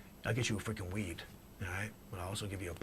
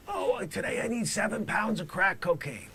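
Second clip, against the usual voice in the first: mock Johnny request; 11 semitones higher, 7 dB louder than usual. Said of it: "quoting Johnny".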